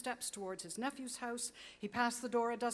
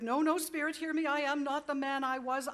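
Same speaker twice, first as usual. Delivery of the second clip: loud, higher, desperate cry for mercy; desperate pleading; 5 semitones higher, 9 dB louder than usual. Acted. Scrooge begging the ghost at his grave, loud desperate cry. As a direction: beg the spirit with rising panic.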